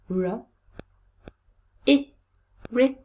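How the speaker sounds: r and i said apart, then blended as ri; short sounds r, i, then ri, with pauses; sounding out. Shown as a demonstration. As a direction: separate r and i, then join them. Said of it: "look at the sounds".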